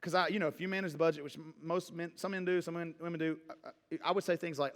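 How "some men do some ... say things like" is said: ordinary talk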